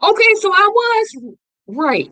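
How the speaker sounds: high and loud, vindicated; triumphant. Real, not acted. Reviewer announcing to viewers she was right, loud and triumphant. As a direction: crow that you were right. Triumphant after checking facts.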